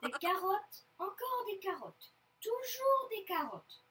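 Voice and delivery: grumble, stretching the last phrase; fed-up child's protest; grumbling. A narrator's reading. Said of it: "bougonne Loustic".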